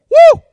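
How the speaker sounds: loud high woo of praise; joyful whoop. Real, not acted. Preacher whoops for the Lord's day, loud and high. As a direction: let out a big happy whoop.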